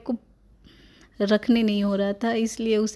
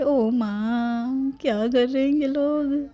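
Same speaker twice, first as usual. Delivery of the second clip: put-on higher baby voice, stretched O MAA; a little baby asking what on earth they're doing; alarmed baby; 5 semitones higher, slower than usual. Baby alarmed at relatives painting her nails, high baby voice.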